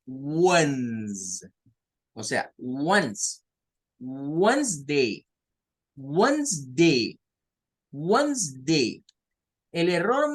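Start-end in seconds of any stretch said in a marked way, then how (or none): 0.0-3.4 "once" slow as wuhns; drawn-out "Ones"; slow demo. Shown as a demonstration. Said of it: "lo vamos a pronunciar como ones". 4.0-9.0 "Wednesday" slow as wenz-dei, no d; slow, silent D. Shown as a demonstration. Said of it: "la letra D ... no suena".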